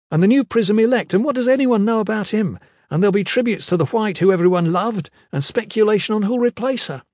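high-pitched, agitated rant; furious. A narrator's reading. Kip rails to Iron Fist about Andros's plan, furious. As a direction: rail against the scheme, pitch held high. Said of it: "Kip was furious".

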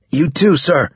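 shouted. A narrator's reading. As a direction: call it out to your officer. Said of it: "Carlos shouted".

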